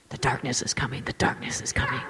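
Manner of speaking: hammed-up low, husky stage whisper; fearful whisper. Christians hiding behind closed blinds, mock-frightened whisper. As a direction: whisper a dread warning.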